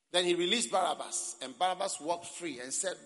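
loud, bright announcing; excited telling. Real, not acted. Preacher telling the congregation Barabbas walked free, loud. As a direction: build up the release.

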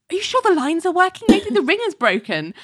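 high, hurried mock fretting; rushed, high-pitched retold fluster; fussing. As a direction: imitate someone fussing over a broken phone. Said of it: "fussing about this".

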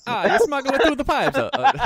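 high, loud Italian plumber voice; hammy video game plumber; Mario voice. Pretend. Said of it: "That's a Mario joke".

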